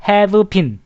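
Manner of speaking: "have been" as two careful words, with a full "v-eu" syllable after "have"; fully separated pronunciation. Shown as a demonstration. Teacher showing students the hard way to say it, "have been" fully separated. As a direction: say "have been" laboriously, sounding the v as its own syllable. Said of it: "이렇게 하려면 힘드시잖아요".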